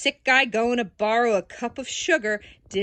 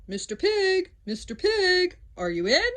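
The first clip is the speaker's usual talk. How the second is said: higher, sing-song calling at the door; 9 semitones higher than usual. Retold or imitated. Wolf calls to the pig at the stick house, sing-song. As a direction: call to the pig through the door. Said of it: "I called".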